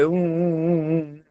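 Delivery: high mock sobbing; fox's fake sob. Fox sobs in front of the deer, mock crying. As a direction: sob like a fox faking tears. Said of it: "હવે રડશો માં".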